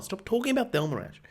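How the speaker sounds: ordinary delivery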